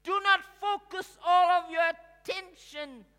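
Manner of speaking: high, very loud preacher's shout; full-force preaching. Real, not acted. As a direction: thunder the warning out to the congregation.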